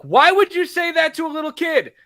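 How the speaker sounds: put-on high, loud protest on the child's behalf; indignant. Scolding Rachel for upsetting a little kid, indignant. Mock outrage at Rachel's cruelty.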